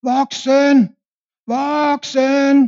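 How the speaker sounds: high, loud call for a grown-up; child shouting. Retold or imitated. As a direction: yell for help like a stuck little boy. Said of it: "Han står bare og råber". (barn der råber højt)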